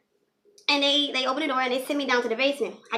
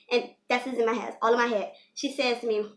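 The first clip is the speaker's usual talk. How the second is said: ordinary talk, nothing marked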